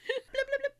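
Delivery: high-pitched turkey blips; failed turkey gobble. Shown as a demonstration. Host doing a turkey, badly faked gobble. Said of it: "me doing a turkey".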